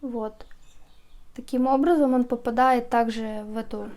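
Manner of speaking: ordinary delivery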